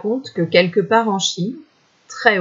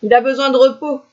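lightly voiced old man, high and quick; a hint of an old villager, pitched up; 6 semitones higher, faster than usual. A narrator's reading. Old man dismissing the hunter, high quick remark. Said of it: "ajouta un vieillard".

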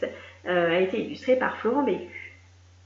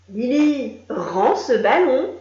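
louder, slow, firm demand; insist, spelling out each word; insisting captain; 3 semitones higher, 6 dB louder, much slower than usual. A narrator's reading. Captain demanding the ball back from Lily. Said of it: "insista le capitaine".

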